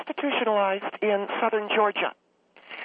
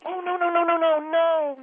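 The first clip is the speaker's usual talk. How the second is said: alarmed denial; 7 semitones higher than usual. Acted. Hastily correcting the host's guess.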